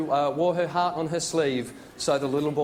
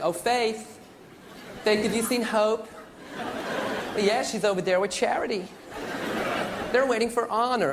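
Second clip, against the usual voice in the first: put-on American accent, calling out to the kids; American parents; 4 semitones higher, slower than usual. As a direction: play a proud American parent, drawn out. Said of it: "Americans out in the street".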